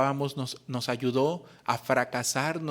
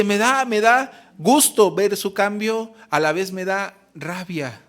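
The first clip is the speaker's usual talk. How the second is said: bitter mother; 6 semitones higher, 6 dB louder, slower than usual. Retold or imitated. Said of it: "Y dice".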